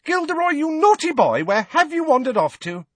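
high, loud mock scolding; calling out. A narrator's reading. Healer calling her wandering patient, high scolding singsong. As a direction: call as to a wayward toddler. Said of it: "a voice called".

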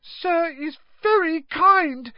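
high, squeaky, slow and moved; tearful squeak. A narrator's reading. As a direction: squeak it out close to tears. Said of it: "he squeaked".

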